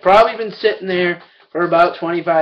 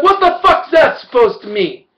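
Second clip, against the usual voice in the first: much higher and louder, outraged; furious yell; 8 semitones higher, 5 dB louder than usual. Retold or imitated. Retelling yelling at the cashier over her remark, loud outraged yell. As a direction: bark it at her, offended.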